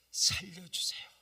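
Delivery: fervent cry to God; pleading cry. Retold or imitated. Pastor crying out over the dead man, fervent plea. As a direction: beg God aloud.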